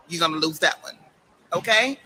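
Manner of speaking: ordinary talk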